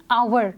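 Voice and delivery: "आवर" said with no h sound, starting on a vowel; silent h. Shown as a demonstration. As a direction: say "आवर" as ow-er, no h at all. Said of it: "हम pronounce कैसे करते हैं?". ("आवर" बिना ह की आवाज़ के)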